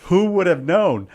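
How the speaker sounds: loud, high, slow incredulous question; exclaiming. Real, not acted. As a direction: throw the question out, amazed. Mock outrage at missing story.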